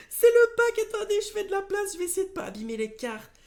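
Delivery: squealing high and loud, then rushing fast; real giddy shriek easing into hurried chatter; frantic excitement. Real, not acted. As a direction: gabble it in a high, overjoyed panic. Overjoyed, handling the precious cards.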